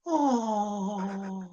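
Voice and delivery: a soft, high, sympathetic "Oh"; mock audience sympathy. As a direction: play the audience's pitying sigh. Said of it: "the audience will always go".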